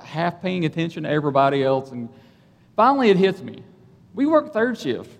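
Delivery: ordinary talk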